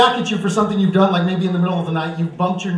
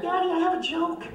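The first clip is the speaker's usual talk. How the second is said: put-on little kid voice; 9 semitones higher, 4 dB quieter than usual. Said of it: "he's gonna be like".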